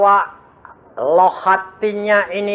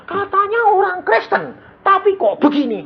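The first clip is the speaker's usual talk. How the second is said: nurse. Retold or imitated. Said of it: "suster bilang".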